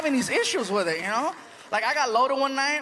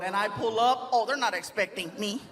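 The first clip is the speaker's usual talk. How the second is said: ordinary talk, nothing marked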